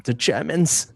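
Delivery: put-on goofy German hot dog man accent; light, self-aware fake German accent. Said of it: "that's the one I can, uh, semi do".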